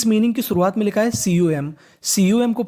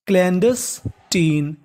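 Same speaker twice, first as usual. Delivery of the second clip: slow drawn-out; much slower than usual. Shown as a demonstration.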